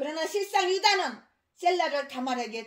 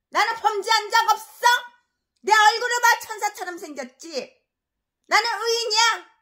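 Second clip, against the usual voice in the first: higher, smug mock innocence; mock self-righteous pastor; 6 semitones higher, 5 dB louder than usual. Preacher mimicking a corrupt pastor, smug mock innocence. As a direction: parody a liar claiming to be a saint.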